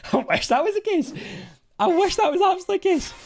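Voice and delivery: very high, loud, squeaky joking voice; squeaky mock wish. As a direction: squeal it like a giddy cartoon. Wishful joke about being rich.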